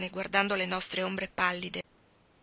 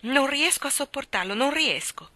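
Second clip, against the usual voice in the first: higher, pleading and distressed; 6 semitones higher, 4 dB louder than usual. A narrator's reading. She pleads with Mark to drop the subject, higher and distressed. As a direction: beg him to stop, can't bear it. She begs to stop discussing.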